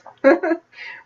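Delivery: quick high self-conscious laugh; a brief embarrassed giggle; short laugh. Real, not acted. Laughs at herself mid-sentence.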